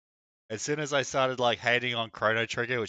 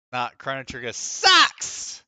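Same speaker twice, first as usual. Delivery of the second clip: mock defiant yell; 3 semitones higher, 9 dB louder, slower than usual. Provocative rant at viewers.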